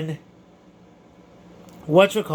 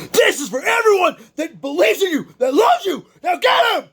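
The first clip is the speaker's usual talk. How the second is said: retold coach shout; 9 semitones higher than usual. Coach Dan roaring his fighter on in the corner, shouting. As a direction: yell him into the final push.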